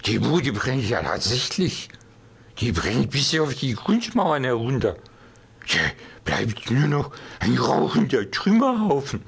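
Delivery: put-on slurred drunk voice; drunken slur. A narrator's reading. Drunk Max keeps slurring.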